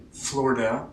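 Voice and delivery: "Florida" as two syllables, FLOR-da; almost no I. Shown as a demonstration. Teacher demonstrating for listeners, "Florida" with almost no I. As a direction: swallow the I in "Florida". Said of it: "there's almost no I".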